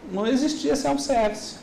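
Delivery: ordinary talk, nothing marked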